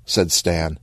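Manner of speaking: plain reading voice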